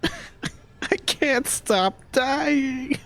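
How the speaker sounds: slow laughing despair. Real, not acted. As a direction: laugh through it, slowly. Laughing through repeated deaths.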